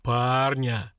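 slow, scornful echo; ponderous, mocking boom; deep otherworldly voice. A narrator's reading. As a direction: draw the word out like an unseen giant. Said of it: "спросил голос".

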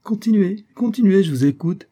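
high, loud mock-amused urging; hooting, lifted voice of amusement; guffawing. A narrator's reading. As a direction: egg him on, hugely amused. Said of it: "s'esclaffa-t-il".

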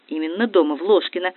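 plain reading voice

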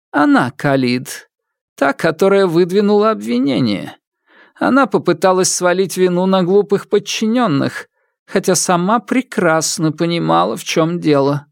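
high, cold verdict; stern widow. Acted. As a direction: condemn her calmly. Widow passes judgment.

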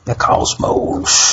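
slow, drawn-out whisper; mock-mystical whisper. Echoing the old space show's title, mock-mystical whisper. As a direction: whisper it slowly for effect. Echoes show title for effect.

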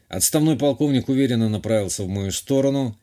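ordinary delivery, nothing marked

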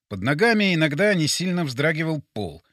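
plain reading voice

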